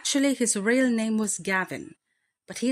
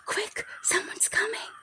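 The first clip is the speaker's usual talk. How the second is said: urgent high whisper; breathless warning whisper, pitched up. Acted. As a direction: hiss a warning to hide. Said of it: "whispered Lottie".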